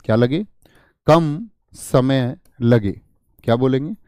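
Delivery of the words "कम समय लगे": very slow, word by word; slow dictation. Shown as a demonstration. Teacher dictates the definition for students to write, slow dictation. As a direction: spell out each word at writing speed.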